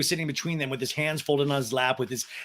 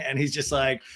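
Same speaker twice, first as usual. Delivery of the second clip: ordinary talk, nothing marked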